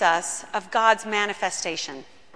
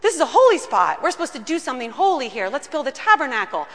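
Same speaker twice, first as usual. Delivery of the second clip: higher, louder, excited rush of ideas; eager Peter; 3 semitones higher, 4 dB louder than usual. Acted. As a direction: bubble over with eager certainty. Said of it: "And he says".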